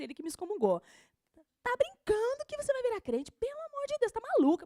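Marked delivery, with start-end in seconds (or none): 1.6-4.6 high, incredulous scoffing voice; mock the boyfriend's outrage. Boyfriend's scolding retold.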